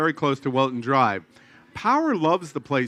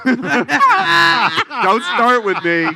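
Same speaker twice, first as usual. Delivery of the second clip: laughing loudly; 8 semitones higher, 7 dB louder, much slower than usual. Real, not acted. Teasing co-host about accent.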